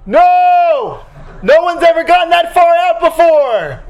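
high, loud, slow laughing yell; an operator yelling up to a stranded rider; shouted reply. Retold or imitated. Operator yelling back from below.

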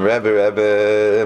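drawn-out, mildly hammed-up call for help; acted plea. Chassid appealing to his Rebbe, mock pleading. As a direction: plead lightly, stretching out the name.

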